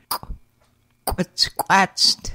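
"qu-, squatched." with a hard throat-stopped kw; "qu-" popped hard from the glottis with rounded lips; glottal kw demo. Shown as a demonstration. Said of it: "Glottal K with a raised W is pronounced as a hard qu".

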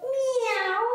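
high, drawn-out meow; playful kitten meow for the kids; kitty meow. Pretend. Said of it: "let's go into cat pose".

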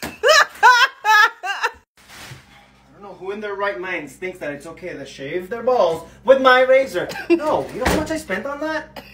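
loud exasperated complaint; indignant rant. Acted. Girlfriend ranting at boyfriend, loudly indignant. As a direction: vent about the ruined razor.

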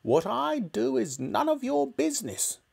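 put-on whispered, high old woman's rebuff; secretive grumpy granny. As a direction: hiss it like a sour old hag. Grandma rebuffs George.

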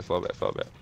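whispering the repeated command; whispered order. Real, not acted. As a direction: hiss it under your breath. Repeats order under breath.

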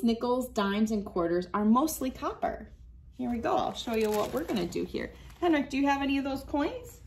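ordinary delivery

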